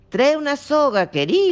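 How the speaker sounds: high, loud urgent call. A narrator's reading. Nazareno calling his wife for a rope.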